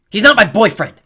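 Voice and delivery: loud indignant shout; girl denying the boy is her boyfriend; yelling. A narrator's reading. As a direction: yell the denial. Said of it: "Clarice tugged her sword".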